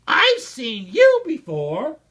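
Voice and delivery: high, loud, slow accusing cry; startled recognition. A narrator's reading. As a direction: point him out in shock. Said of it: "gasped Maisie".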